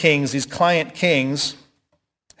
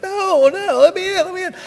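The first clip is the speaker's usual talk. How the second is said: much higher, urgent pleading at the door; desperate high Peter; 4 dB louder than usual. Retold or imitated. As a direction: beg to be let in, voice pitched way up. Peter voiced begging at gate.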